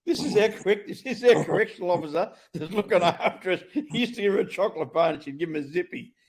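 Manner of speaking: high, loud words through laughter; laughing it out, barely holding it together; laughing while telling. Real, not acted. Laughing while telling funny story.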